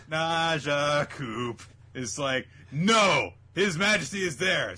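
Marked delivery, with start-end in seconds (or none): 2.7-4.8 high, forceful acted objection; loud protest. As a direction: object loudly, pitch pushed up. Voicing an enemy's protest.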